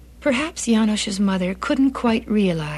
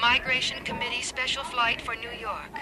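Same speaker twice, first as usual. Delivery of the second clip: airport announcement over a loudspeaker; distant PA speaker voice; over the intercom; 3 semitones higher, 8 dB quieter than usual. Acted. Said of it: "a voice came over the speaker".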